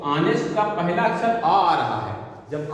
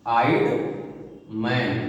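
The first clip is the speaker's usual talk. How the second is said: slow dictation; 4 semitones lower, slower than usual. Shown as a demonstration. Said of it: "अब अगला जैसे आ रहा है".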